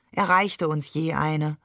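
ordinary delivery

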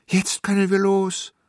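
put-on high, loud, eager child voice; excited kid calling out; child's joyful call. A narrator's reading. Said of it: "rief ich".

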